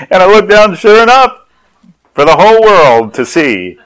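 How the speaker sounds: high, loud storytelling climax; comic mortification. Retold or imitated. Dad recalling being exposed to the whole church, mortified. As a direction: proclaim the humiliation at full voice.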